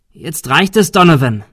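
fed-up shout at the gunman; angry shout. Acted. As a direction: shout him down. Confronting the gunman.

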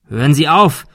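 urgent acted call. A narrator's reading. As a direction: shout for him to stop. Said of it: "rief Thomas".

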